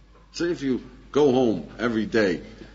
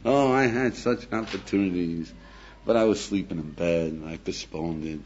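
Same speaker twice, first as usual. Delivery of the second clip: put-on regretful old man; 6 semitones lower than usual. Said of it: "Did you ever hear a guy talking like that?".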